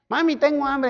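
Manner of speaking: put-on high whiny little child asking mom for food; mock baby voice. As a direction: play the hungry baby. Grown man playing a nursing baby.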